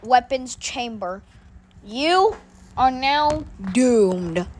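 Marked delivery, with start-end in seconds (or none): 1.8-4.5 ominous slow. Acted. Villain announces doom.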